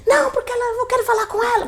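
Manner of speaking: high, whiny voice of the ex begging at the door; ex-boyfriend begging her friends, high and whiny; pleading ex-boyfriend. Retold or imitated.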